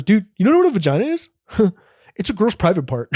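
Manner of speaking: put-on high, fast kid voice; know-it-all kid. Boy explaining vaginas to classmates in a squeaky kid voice. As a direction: squeak it out like a smug sixth grader. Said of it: "He goes".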